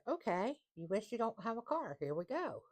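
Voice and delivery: lightly voiced universe, playful. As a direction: play the universe happily obliging. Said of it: "The universe says".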